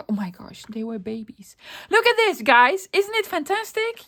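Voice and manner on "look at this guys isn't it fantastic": high, loud burst of enthusiasm; excited, pitched-up showing off; sudden bright cheer. Real, not acted. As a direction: exclaim it loudly to change the mood. Forced switch from tears to cheer.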